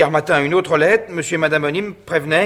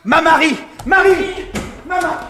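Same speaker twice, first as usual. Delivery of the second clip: high, loud shout of panic; alarmed cry; 6 dB louder than usual. Acted. Husband as his wife Marie collapses, panicked shout. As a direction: cry out her name in sudden alarm.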